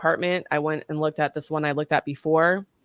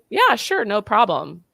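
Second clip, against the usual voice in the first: high, loud retold breezy reply; breezy agreement; 5 semitones higher, 5 dB louder, slower than usual. Woman retelling her reply to ex's thanks, breezy. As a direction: toss back an easy agreement, pitched way up.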